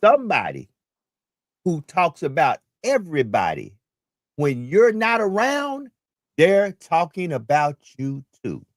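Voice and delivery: slow, deliberate repeat with long pauses between phrases; drawn-out and emphatic, pausing after each chunk; real slow. Shown as a demonstration. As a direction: spell it out slowly, phrase by phrase. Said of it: "say that again real slow".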